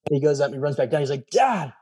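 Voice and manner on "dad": put-on excited kid. Son amazed by new house.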